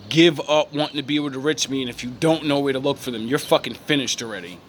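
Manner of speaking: ordinary talk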